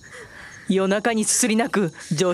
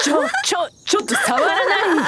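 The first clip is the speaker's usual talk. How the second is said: startled squeal; 8 semitones higher, faster than usual. Real, not acted. Doll squealing while being touched.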